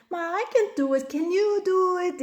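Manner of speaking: put-on high, pleading kid voice; whiny child. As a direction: beg like a lazy toddler. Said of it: "they're like".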